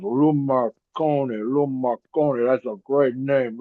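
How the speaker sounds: slow, musing voice savoring the name; Bruno Sammartino savoring. Retold or imitated. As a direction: roll the name around slowly, impressed. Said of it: "he goes".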